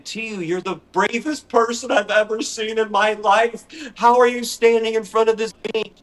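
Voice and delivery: high, loud amazed voice; hammed-up awe; awed praise. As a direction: gush at her bravery. Praising friend catching spider.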